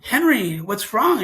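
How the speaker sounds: retold urgent, high, loud question; alarmed parent. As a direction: burst in worried for the child. Father rushing in to shouting child.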